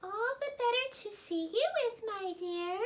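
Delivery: very high, sly granny-wolf voice; wolf pretending to be grandmother; sneaky wolf. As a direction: purr it like a wolf in disguise. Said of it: "said the wolf".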